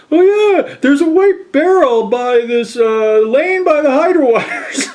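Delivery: a hint of a keen neighbour catching on; agreeing husband.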